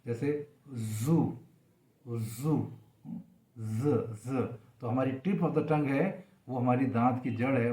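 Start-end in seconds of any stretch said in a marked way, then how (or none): 0.7-2.7 जू slow, a buzzing z from the tooth ridge. Shown as a demonstration. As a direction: buzz the z in जू. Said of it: "English में जब बोलेंगे".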